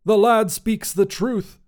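high, drawn-out sighing wizard. A narrator's reading. Wizard agrees with his fellow captive, sighing. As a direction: sigh it out, high and slow. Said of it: "Grandois sighed".

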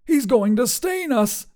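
high, drawn-out cry of alarm; panicked shout. A narrator's reading. Wizard warns his companion of the stain, shouting. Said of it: "Grandois shouted".

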